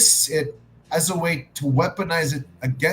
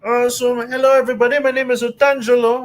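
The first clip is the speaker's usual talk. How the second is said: high put-on voice and accent, a touch louder; Otangelo voice and accent; 8 semitones higher, 3 dB louder than usual. Explaining the Kafka trap to viewers as Otangelo, in a high put-on voice. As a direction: slip into the old high character voice to explain the term. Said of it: "I miss doing this voice and accent".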